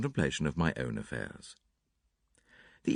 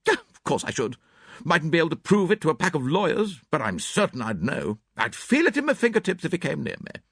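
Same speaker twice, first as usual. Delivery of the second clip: put-on high, brisk, blunt older lady; clipped spinster, sure of herself; Miss Howard; 9 semitones higher, 3 dB louder than usual. A narrator's reading. Miss Howard voiced in reading.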